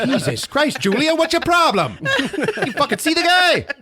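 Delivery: high, squawking comic outburst; mock exasperation. Someone berating Julia over Frank, mock exasperation.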